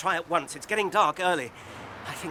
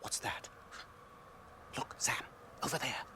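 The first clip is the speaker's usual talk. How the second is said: much softer, hushed warning; hushed alarm; 11 dB quieter than usual. Acted. Frodo points out Gollum to Sam, hushed alarm. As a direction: keep it down, danger is near.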